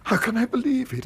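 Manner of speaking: reeling from terrible news, words catching; shocked dismay. Acted. Father learning his niece betrayed him, in shocked dismay. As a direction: voice jumps high on awful, stammering in disbelief.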